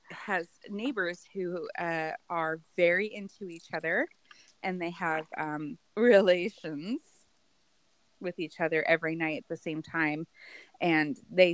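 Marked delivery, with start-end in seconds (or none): none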